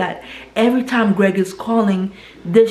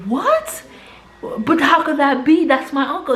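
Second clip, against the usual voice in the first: shocked disbelief; 7 semitones higher than usual. Retold or imitated. Niece hears her uncle was arrested, high shocked disbelief. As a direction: voice jumps way up in stunned disbelief.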